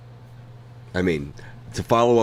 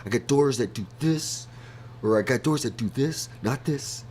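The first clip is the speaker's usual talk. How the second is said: show-off rich guy; 5 semitones higher, 4 dB quieter than usual. Retold or imitated. Quoting Russ showing off doors.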